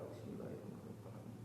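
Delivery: breathed recitation, very soft; whispered prayer. Acted. Preacher imitating a kiai whispering a blessing over water.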